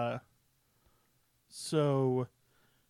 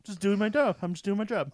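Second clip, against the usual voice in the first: upbeat character voice; 5 semitones higher, 4 dB louder than usual. Retold or imitated.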